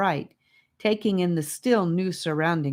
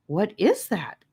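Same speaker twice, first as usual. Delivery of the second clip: in awe; 4 semitones higher than usual. A narrator's reading. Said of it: "asked Gabe in awe".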